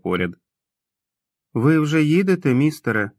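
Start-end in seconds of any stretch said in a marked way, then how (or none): 1.5-3.1 put-on higher, timid childlike question; hesitant. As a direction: ask shyly, afraid of the answer. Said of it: "нерішуче промовив Том". (нерішуче, по-дитячому високо)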